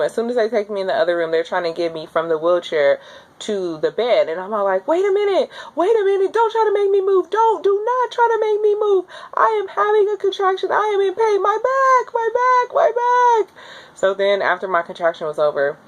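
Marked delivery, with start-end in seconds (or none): 4.9-13.5 high, strained cry of pain; pained pleading. Retold or imitated. In labor, begging the nurses not to move her.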